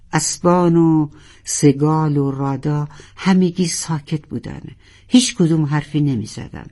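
ordinary delivery

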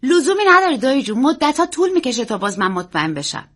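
put-on high, loud child's protest; eager young nephew, emphatic; insistent boy. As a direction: brush off the uncle's worry as a boy. Boy brushes off uncle's worry.

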